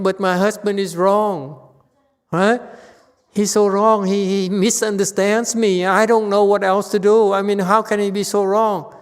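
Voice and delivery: loud, high-pitched indignant complaint; hammed-up exasperated griping; complaining wife. Teacher mimics wife who says husband is wrong, loudly indignant.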